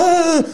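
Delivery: sudden high scream, retelling the scare; startled scream. Retold or imitated. Gamer imitating his own scream at the scare. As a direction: shriek as if something appeared beside you. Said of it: "Und ich so".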